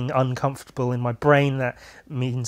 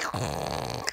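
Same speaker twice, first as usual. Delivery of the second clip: breathy laugh; 9 semitones lower, 8 dB quieter than usual. Real, not acted. Laughing at pink eye joke.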